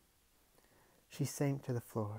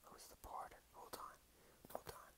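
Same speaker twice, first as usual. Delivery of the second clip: whispering; 19 dB quieter, faster than usual. Real, not acted. Reader stops, muttering.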